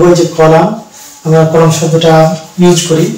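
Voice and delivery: ordinary talk, nothing marked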